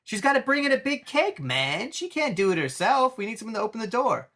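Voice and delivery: mock casual Joker. Joker explaining the cake plan, breezy mock voice. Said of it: "He's like".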